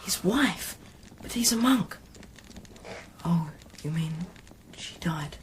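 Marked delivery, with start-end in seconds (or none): 3.2-5.4 hushed dawning whisper; soft unvoiced realisation. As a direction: breathe it out as it sinks in. Whispering near sleeping monk.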